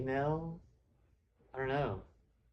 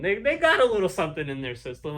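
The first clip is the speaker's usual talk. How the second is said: usual speaking voice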